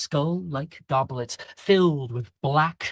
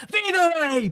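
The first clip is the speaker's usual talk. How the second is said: much higher, louder yell; the headmaster yells louder still; 3 dB louder than usual. Acted.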